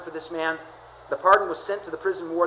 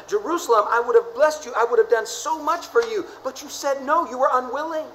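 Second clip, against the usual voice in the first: pleading Jesus; 6 semitones higher, 5 dB louder than usual. Acted. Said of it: "Jesus said".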